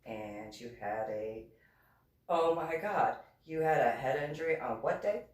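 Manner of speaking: low-pitched, halting disbelief; imitated stunned commander. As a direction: imitate an officer struggling to process it. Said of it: "he was just like".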